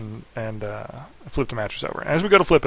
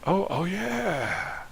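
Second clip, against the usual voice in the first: very slow, higher, softer dawning oh yeah; sheepish realization; 7 semitones higher, 6 dB quieter, much slower than usual. Retold or imitated. Brother realizing he's caught, slow sheepish dawning. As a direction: let it dawn on you, caught out.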